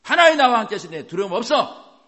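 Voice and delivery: high, forceful shouted proclamation; roaring with full strength. Shown as a demonstration. Preacher demonstrating lion-like proclamation of truth, roaring. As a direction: roar it out with all your might. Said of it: "이렇게 세게 힘을 다해서 으르렁거리라고".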